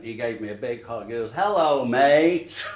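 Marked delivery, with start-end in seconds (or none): 1.3-2.6 put-on a North American accent putting on a British "Hello, mate", louder and higher; North American saying mate. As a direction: imitate a North American greeting his friend with "mate". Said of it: "when you hear someone with a North American accent".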